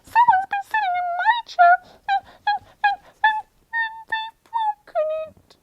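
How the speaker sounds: very high, quick squeaky bear; tiny upset bear cub voice; little high Baby Bear. Acted. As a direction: squeak it fast, stammering toward tears. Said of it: "in his little high voice".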